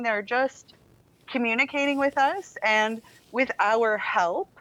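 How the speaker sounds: normal talking voice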